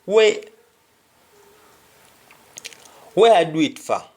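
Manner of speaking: "where I do it for" in a Jamaican Patois lilt, "for" as "fa"; a puzzled Patois question. Shown as a demonstration. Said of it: "somebody would say".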